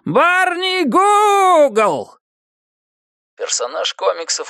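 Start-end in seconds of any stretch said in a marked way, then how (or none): 0.0-2.2 long, very high drawn-out howl; wild howl. A narrator's reading. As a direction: howl the name to mock him. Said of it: "истошно завопил".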